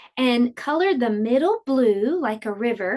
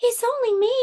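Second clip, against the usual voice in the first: high squeaky little goat; tiny goat, timid and high; 12 semitones higher, faster than usual. Acted. Littlest goat answers the troll in a tiny high voice. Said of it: "said the littlest billy goat Gruff".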